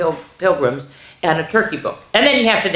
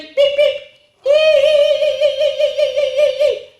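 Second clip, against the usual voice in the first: very high squeaky beeps and squeals; silly sounds; 5 dB louder than usual. A narrator's reading. Reader forced into silly sounds, high squealing. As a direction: squeal a run of tiny high ees. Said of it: "make silly sounds like".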